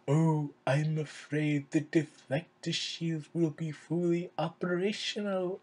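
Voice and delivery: slow, drawn-out cartoon bad guy voice; Palpatine as cartoony villain. Evil overlord taunting like a cartoony bad guy, hammed-up. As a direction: put on a mock-villain voice, taking it slowly. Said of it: "acting like a cartoony bad guy".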